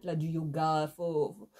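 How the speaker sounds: ordinary talk, nothing marked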